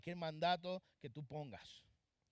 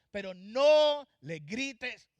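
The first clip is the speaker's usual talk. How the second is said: a slow, drawn-out shout of "Pero no le grites"; 4 semitones higher, 14 dB louder, much slower than usual. Shown as a demonstration. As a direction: bellow "Pero no le grites" slowly, much louder. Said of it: "¿Por qué yo estoy gritando?". (gritando fuerte y lento «Pero no le grites»)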